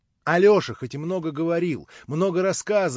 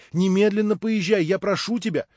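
louder, higher urging, pressing hard; urgent plea; 6 semitones higher, 6 dB louder than usual. Acted. Natasha urging Alyosha to leave immediately, pressing and louder. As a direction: push him to go right now.

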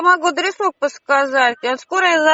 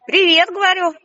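louder and higher, repeating; repeated louder; 7 semitones higher than usual. Real, not acted. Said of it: "Что, простите?".

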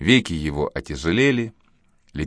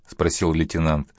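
usual speaking voice

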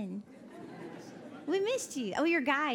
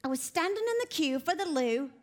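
imitated English accent; an American's go at a British accent; English lady; 3 semitones higher than usual. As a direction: lightly mimic a British woman's accent. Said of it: "and she goes".

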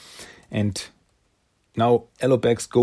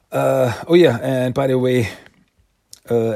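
normal talking voice

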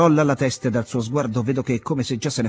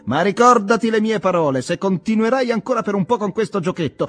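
raised, scornful toast and threat; mocking warning; 8 semitones higher, 3 dB louder than usual. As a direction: toast him with contempt, then warn.

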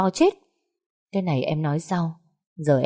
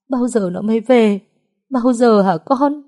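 tearful pleading; 6 semitones higher, 5 dB louder than usual. A narrator's reading. Mother asking her children when her daughter returns, crying. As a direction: beg through tears.

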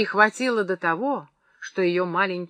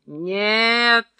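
higher, drawn-out cry of refusal; insistent held shout; narrated yell; 4 semitones higher, 4 dB louder than usual. A narrator's reading. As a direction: cut in with a raised voice. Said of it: "заорал Клычков".

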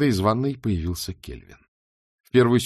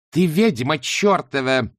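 high, loud, slow yell of rage; furious shout; 6 semitones higher, 5 dB louder, slower than usual. Acted. Shayana screaming at her captive, furious shout. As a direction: spit the curse at her. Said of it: "крикнула Шаяна".

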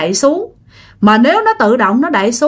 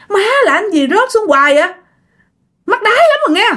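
high-pitched acted exasperation; whine-curse at the thing, pitch shooting up; exasperated cursing; 8 semitones higher, faster than usual. Woman swearing at the lid that keeps dropping, exasperated cursing. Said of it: "bạn bực rồi nha má".

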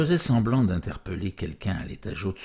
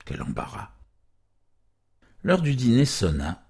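ordinary delivery, nothing marked

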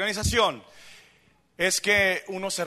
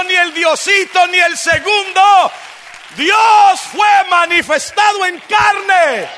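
loud, high, emphatic preacher's shout; fiery pulpit delivery; full-force preaching; 7 semitones higher, 10 dB louder, slower than usual. Real, not acted. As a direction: proclaim it to the congregation, stretching key words.

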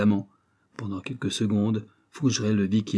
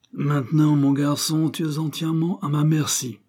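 higher, louder threatening growl; a villain gloating at his prisoner; menacing grumble; 6 semitones higher, 5 dB louder than usual. A narrator's reading. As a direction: lean over the captive and threaten him. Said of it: "maugréa-t-il en se penchant vers Fougeray".

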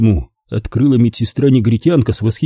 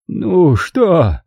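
hollow voice; 7 semitones higher than usual. A narrator's reading. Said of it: "пустым голосом произнёс Апостини".

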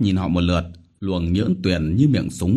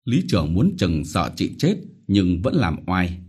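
ordinary delivery, nothing marked

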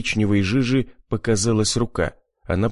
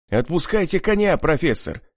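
raised, higher urgent call; shout; 7 semitones higher than usual. A narrator's reading. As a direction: shout at the professor to let go. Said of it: "крикнул я".

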